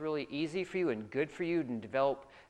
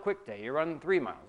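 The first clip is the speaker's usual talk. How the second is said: normal talking voice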